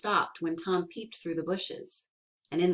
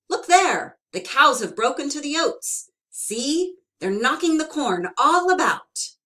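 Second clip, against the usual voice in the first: loud, sharp scolding, higher; sharp, cross; 5 semitones higher, 9 dB louder than usual. Acted. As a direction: snap at the pestering boy. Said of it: "answered Tom sharply".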